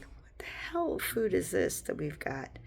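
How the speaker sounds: slow hushed whisper, no voice; breathy disgusted whisper. Retold or imitated. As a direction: whisper it in mock disbelief. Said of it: "And you're like".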